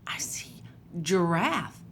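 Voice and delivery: put-on hushed whisper to the kids. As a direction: whisper it like a secret discovery. Pointing out animals to children.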